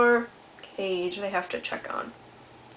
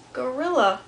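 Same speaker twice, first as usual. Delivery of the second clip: ordinary delivery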